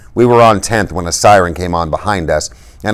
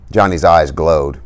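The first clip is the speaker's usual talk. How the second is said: plain reading voice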